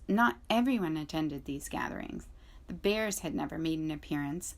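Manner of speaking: ordinary delivery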